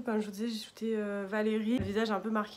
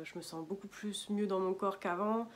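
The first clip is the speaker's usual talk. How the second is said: usual speaking voice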